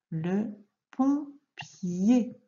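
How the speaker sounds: very slow, pompier split in two; syllable by syllable. Shown as a demonstration. Teacher reading the last word to a pupil, syllable by syllable. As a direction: stretch out both syllables for learners.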